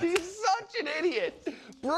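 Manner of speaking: very high, helpless amusement; real laughter pushing the voice way up; laughing hard. Real, not acted. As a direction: gasp it out while cracking up. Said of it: "You're laughing so hard".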